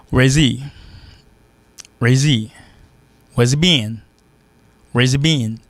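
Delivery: "Where's he been" run together as "where-zee-been"; dropped H. Shown as a demonstration. Teacher giving examples, "Where's he been" with silent H. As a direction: link "Where's he" with no H. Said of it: "li vin he".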